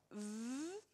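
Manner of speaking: "v" as a long voiced buzz, quite soft; isolated phoneme. Shown as a demonstration. Teacher opposing it to f, "v" held with voice. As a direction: hold "v" buzzing on the lip, not the letter name. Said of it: "les phonèmes".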